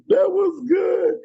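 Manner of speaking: high, slow, laughing praise; host praising the guest's poem while still laughing. Real, not acted. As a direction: squeeze it out through a laugh.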